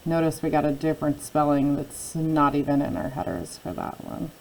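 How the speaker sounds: ordinary delivery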